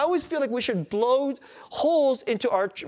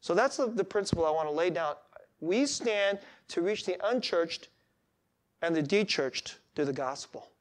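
usual speaking voice

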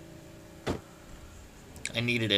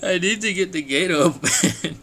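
imitating calm words through laughter; laughing mock calm; 4 semitones higher than usual. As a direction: try to quote him calmly but crack up. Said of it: "He says so calmly".

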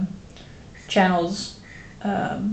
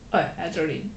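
put-on Dutch accent; 4 dB quieter, faster than usual. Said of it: "it's my amazing Dutch accent".

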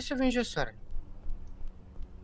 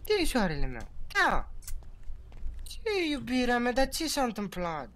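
tender; 3 semitones higher, 12 dB quieter than usual. Acted. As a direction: coax her gently. Sweet-talking in roleplay.

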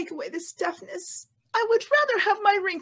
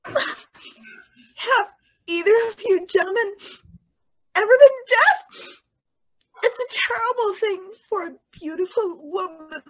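wailing; 3 semitones higher, 7 dB louder than usual. Acted. Sniffling woman wails.

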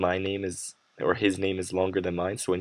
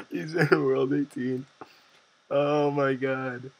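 laughing; 6 semitones higher, 3 dB louder than usual. Real, not acted. Laughing at troll result.

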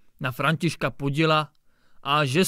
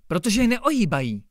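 critical; 8 semitones higher, 7 dB louder than usual. A narrator's reading. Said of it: "pravil pan Hejda kriticky".

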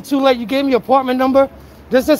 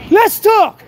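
shouting; 6 semitones higher, 3 dB louder than usual. Real, not acted. Chasing him, calling out.

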